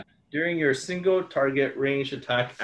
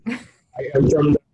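loud, higher robot announcement; 3 semitones higher, 9 dB louder, faster than usual. Pretend. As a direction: boom it out like a movie robot. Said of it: "Now you sound like a robot".

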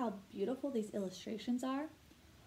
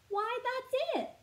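much higher, slow joyful exclaim; 13 semitones higher, 3 dB louder than usual. Acted. A little girl sees the lost lamp, high and thrilled. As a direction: cry it out with delight. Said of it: "Sally exclaimed".

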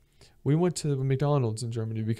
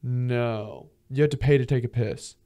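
ordinary delivery

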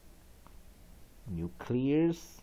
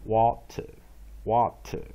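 water drawn out slowly and a bit louder; slow demo; 5 dB louder, much slower than usual. Shown as a demonstration. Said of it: "也有人写念water".

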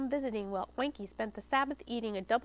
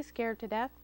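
usual speaking voice

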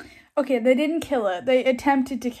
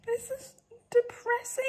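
mock crying; 11 semitones higher, 5 dB quieter than usual. Wailing about sad book plot.

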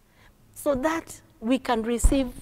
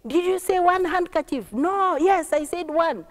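high-pitched, raised calling; acted excited clamour; excited shouting; 9 semitones higher, 4 dB louder than usual. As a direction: shout back and forth like astonished passengers. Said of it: "all of a sudden they started shouting".

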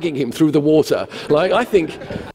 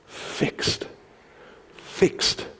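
whispered emphasis; 9 dB quieter than usual. Acted. Stressing keeping eyes fixed.

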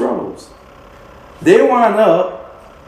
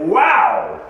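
high, drawn-out awe; amazed wow; 9 semitones higher than usual. Acted. Preacher marvelling at what one angel did, high amazed wow. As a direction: let "wow" soar in astonishment.

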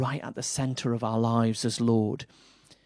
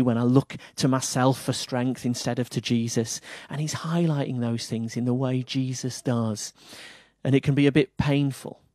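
usual speaking voice

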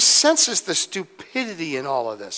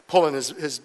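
normal talking voice

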